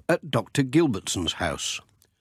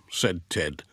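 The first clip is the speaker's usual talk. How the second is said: plain reading voice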